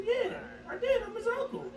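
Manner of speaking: high-pitched, laughing self-justification; defiant retort. Retold or imitated. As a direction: retell a stubborn comeback in a high voice, amused. Retells defiant reply to friend.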